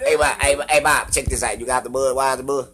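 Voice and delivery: hammed-up country buddy; exaggerated country voice. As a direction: hail a buddy in a put-on country twang. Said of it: "I can sound as country as I fucking wanna".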